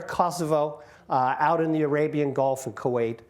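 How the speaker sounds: ordinary talk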